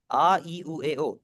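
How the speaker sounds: आ, ई, उ, ए, ओ said as clear separate vowels; vowel demo. Shown as a demonstration. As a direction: spell out आ ई उ ए ओ one by one. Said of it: "मैं चाहूंगा थोड़ा सा आप भी प्रोनाउंस करें".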